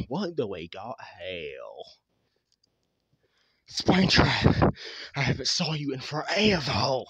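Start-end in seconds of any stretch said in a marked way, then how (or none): 3.7-7.0 big surprised hello across the room; loud excited greeting. Acted. Foxy greets Springtrap after months away, loud and excited. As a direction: call the name out loud and bright. Character greets long-absent friend.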